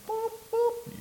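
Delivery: computer beep. Pretend. Computer pulling up a law.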